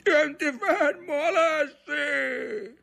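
high, stretched-out urgent cry; shouted warning. A narrator's reading. Grandfather calling to boy at boat's other end, shouting. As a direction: call the name long across the wind. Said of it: "gli gridò il nonno".

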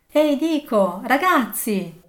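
loud, high hearty hail; shouted call. A narrator's reading. Fezziwig calling to his boys, hearty shout. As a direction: hail them loudly from across the room. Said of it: "gridò Fezziwig".

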